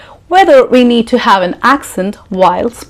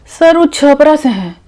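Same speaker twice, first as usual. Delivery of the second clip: higher-pitched candidate answering in Hindi; imitated interviewee, higher Hindi reply; mock job candidate; 6 semitones higher, much faster than usual. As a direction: drop the accent and answer the interviewer, high-voiced. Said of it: "You go for an interview".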